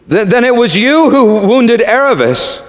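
high, halting question; stammering boy. A narrator's reading. Shasta confronting the lion, stammering high. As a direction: stammer upward as a startled boy.

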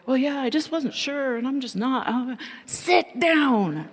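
imitating flustered Ted.

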